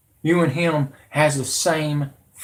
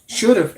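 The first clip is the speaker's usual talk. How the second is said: should punched high and loud; stress on should; 7 semitones higher, 5 dB louder than usual. Real, not acted. Lecturing Trump on what he should have done, stress on should. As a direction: hit should hard. Correcting himself mid-rant.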